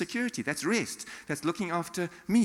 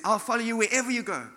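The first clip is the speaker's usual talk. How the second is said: high, louder eager pledge; excited zeal; 8 semitones higher, 5 dB louder, faster than usual. Acted. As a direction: play the eager disciple volunteering. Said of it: "in excitement, in, in zeal".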